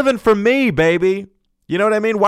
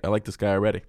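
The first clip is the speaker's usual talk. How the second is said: normal talking voice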